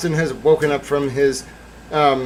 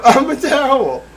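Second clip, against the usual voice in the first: mock gadget yell; 12 semitones higher, 6 dB louder than usual. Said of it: "just yelled out".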